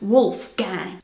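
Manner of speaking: "Wolfgang" in a slow American accent, "wolf gang". Shown as a demonstration. Showing how Americans say the German name "Wolfgang", American accent. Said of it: "Pronounced with an American accent".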